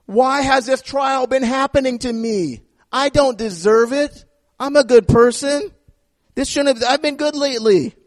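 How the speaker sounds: high, whining protest at God; mock aggrieved believer; whiny complaint. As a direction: sound wronged and entitled. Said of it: "we come to God and say".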